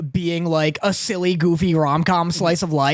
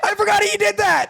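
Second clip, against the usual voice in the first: high squeaky mock sob; high fake crying; 13 semitones higher than usual. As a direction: whimper it in a high voice. Acted anime line, crying.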